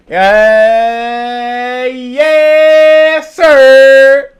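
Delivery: loud, high, drawn-out cheer; hyped shout. Real, not acted. Streamer kicking off his reaction video, hyped shout. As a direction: belt it out to kick things off.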